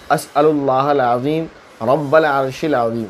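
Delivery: slow, measured Arabic recitation; dua recitation. Shown as a demonstration. Mufti teaching viewers a healing dua, slow devotional recitation. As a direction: recite the prayer slowly in a chanting tone. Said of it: "بس اتنا فرق ہے".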